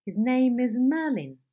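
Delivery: stepped pitch falling down each accent; intonation demo with a stepping fall; stepping head. Shown as a demonstration. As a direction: step each accent down, ending in a fall on Merlin. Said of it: "Stepping head".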